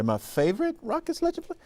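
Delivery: high-pitched, surprised repeat of the question; incredulous echo. Real, not acted. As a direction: voice shoots way up, taken aback.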